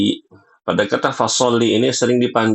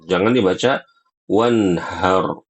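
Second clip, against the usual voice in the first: usual speaking voice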